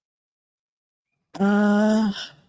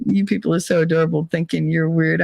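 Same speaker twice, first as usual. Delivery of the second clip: ordinary delivery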